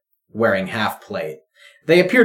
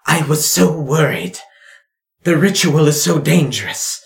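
higher, fretting mother voice; worried mother; 6 semitones higher, 4 dB louder than usual. Acted. Mother hugging her daughter after the ritual, high worried voice. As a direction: gush with motherly worry. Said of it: "she goes".

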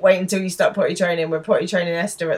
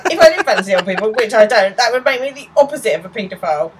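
acted rapid, louder protest; indignant retort; 4 semitones higher, 7 dB louder, faster than usual. Will rebuts Jay's taunt, indignantly. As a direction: rush it out, pitched up in mock indignation.

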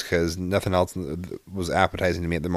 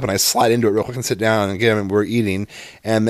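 usual speaking voice

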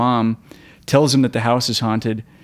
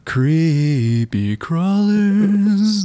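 very slow drawn-out ad voice; commercial announcer; 5 semitones higher, much slower than usual. Retold or imitated. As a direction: drag it out like a TV ad. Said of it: "That was a commercial".

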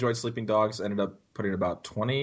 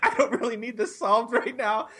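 higher, meek imitation of a dejected customer; retold apologetic caller; 10 semitones higher than usual. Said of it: "he's super apologetic".

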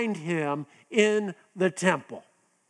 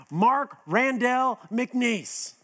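slow, higher full-name scolding; parents' full-name scolding; 5 semitones higher, much slower than usual. Retold or imitated. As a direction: spell out the full name like a parent calling a child in trouble. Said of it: "parents used to use my middle name".

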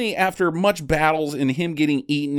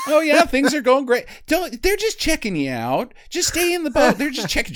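mock breezy trainer; 10 semitones higher than usual. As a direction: chirp it while men get mauled. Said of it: "voicing trainer again".

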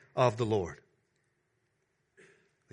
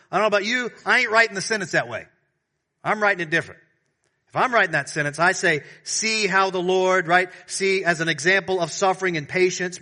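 higher, louder lively preaching; animated preaching; 7 semitones higher, 7 dB louder than usual. Acted. Preacher playfully rewriting James' sentence for the brothers. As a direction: argue it with energy.

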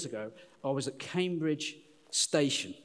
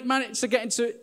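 normal talking voice

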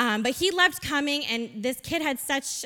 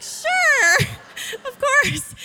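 high, loud squeal of agreement; delighted yes; 13 semitones higher, 6 dB louder, much slower than usual. Retold or imitated. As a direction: gush a very high, emphatic yes. Her delighted answer to the boy.

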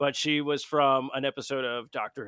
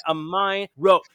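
normal talking voice

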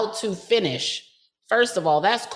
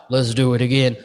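mock Mike; 10 semitones lower, faster than usual. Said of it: "Mike talking about".

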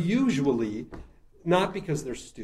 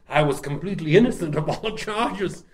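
louder, theatrical horror-actor impression; Boris Karloff; 3 semitones higher, 6 dB louder than usual. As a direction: protest grandly as a horror-film doctor. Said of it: "doing a Boris Karloff impression".

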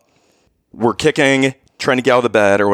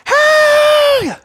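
put-on piercing, very high girlish shriek; high-pitched scream; 6 dB louder than usual. Shown as a demonstration. Husband showing wife his dream yell, high-pitched girly scream. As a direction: let out a loud, crazy high scream. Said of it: "this crazy high-pitched scream".

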